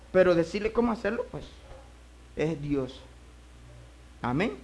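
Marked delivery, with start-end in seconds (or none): none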